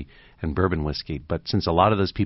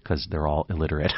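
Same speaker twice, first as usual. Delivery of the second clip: normal talking voice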